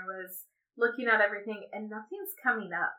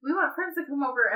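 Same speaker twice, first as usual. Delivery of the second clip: put-on high, fast pestering kid voice; imitating the kids; 6 semitones higher, 3 dB quieter than usual. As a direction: mimic children tugging at mom, quick and high. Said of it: "They were like".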